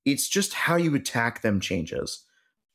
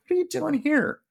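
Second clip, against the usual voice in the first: much higher, baffled; genuinely thrown, asking in disbelief; startled question. Retold or imitated. Driven to a construction site.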